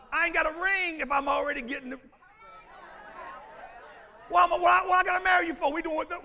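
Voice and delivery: loud, high fervent preaching; fiery pulpit exhortation; full-force preaching. Acted. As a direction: drive it home to the pews.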